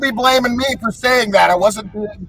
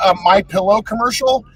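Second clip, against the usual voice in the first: usual speaking voice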